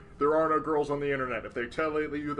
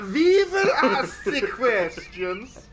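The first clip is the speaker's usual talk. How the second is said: put-on drawn-out, loud German-Swedish accent; German turning Swedish; 9 semitones higher, 9 dB louder, much slower than usual. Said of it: "your German turned into a Swedish".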